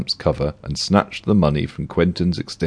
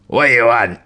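snappy tramp, higher and louder; spat out; 5 semitones higher, 4 dB louder than usual. Acted. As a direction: spit the words at him, rudely. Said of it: "he spat".

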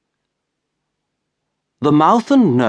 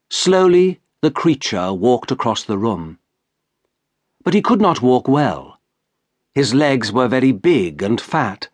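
usual speaking voice

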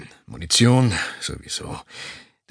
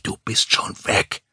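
breathy whisper, slowed, pressing; urgent inner whisper. Acted. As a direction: hiss it like a voice inside his head. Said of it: "flüsterte eine innere Stimme eindringlich". (eindringliches, langsames Flüstern)

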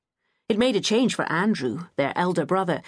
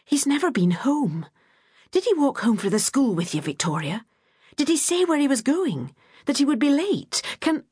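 frantic worry; 8 semitones higher than usual. Acted. Frantic mother asking about son.

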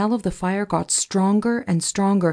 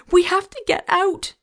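very high, urgent yell; Dot; 12 semitones higher than usual. A narrator's reading. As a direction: yell it in panic, voice pitched way up. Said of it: "Dot yelled".

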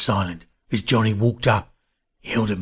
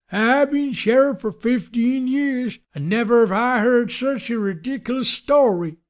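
disbelieving sheriff. A narrator's reading. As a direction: scoff as a high-voiced lawman. Disbelieving sheriff scoffs at their story.

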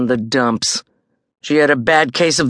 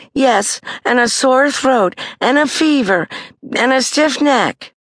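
Judy listing symptoms; 11 semitones higher than usual. A narrator's reading.

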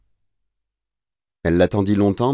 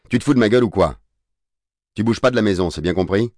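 scolding, starting higher then settling; angry father; 4 semitones higher, 3 dB louder, faster than usual. Acted. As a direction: lay down the law to a son. Père furieux qui interdit de sortir. (colère paternelle, ton sévère)

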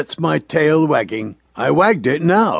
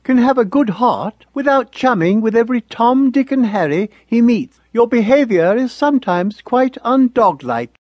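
higher, prim motherly dog lecturing her pup; Mother's voice; 8 semitones higher than usual. A narrator's reading. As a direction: play the proper old mother dog, scolding gently. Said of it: "she used to say".

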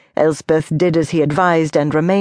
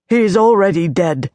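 unsteady voice. A narrator's reading. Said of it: "in an unsteady voice".

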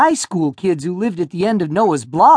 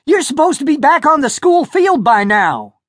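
urgent shouting; 9 semitones higher, 4 dB louder than usual. A narrator's reading. Roger shouting still.

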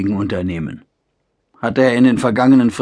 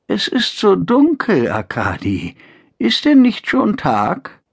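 put-on frail old mother; 8 semitones higher than usual.

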